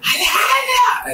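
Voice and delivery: high-pitched, drawn-out mock scream; imitated scream. Mother yells for her husband, imitated high scream. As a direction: wail the name as a hysterical mother would. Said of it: "empezó a gritar".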